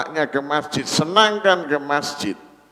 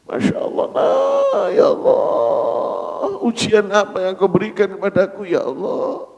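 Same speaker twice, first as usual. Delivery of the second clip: mild tearful prayer; 6 semitones higher than usual. Acted. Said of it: "dia hanya menangis".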